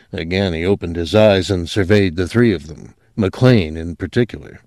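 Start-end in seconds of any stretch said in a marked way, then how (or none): none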